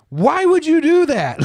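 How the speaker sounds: outraged high-pitched shout, drawn out; Steve Harvey. Retold or imitated. Retelling a TV host after his gift got smashed, outraged mock shout. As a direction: imitate an appalled, wounded yell. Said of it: "you couldn't tell if he was really pissed".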